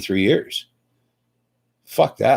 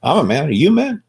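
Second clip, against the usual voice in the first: rising higher and higher on each man; pointed question; 6 semitones higher, faster than usual. Retold or imitated. To a cop at his car window, pointed mock question. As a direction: needle him, pitch climbing to a high squeak. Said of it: "I just look at him and say".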